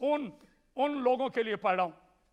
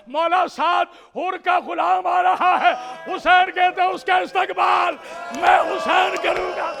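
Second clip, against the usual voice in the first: high, loud sustained wailing oratory; full-force majlis preaching; 6 semitones higher, 6 dB louder than usual. Acted. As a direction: cry the tragedy out to the mourners.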